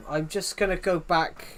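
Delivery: ordinary talk, nothing marked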